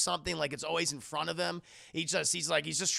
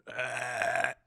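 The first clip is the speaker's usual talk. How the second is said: low creaky drone into a gasp; possession groan; 6 semitones lower than usual. Retold or imitated. Mother being taken over, eerie groan and gasp. Acting the possession taking hold.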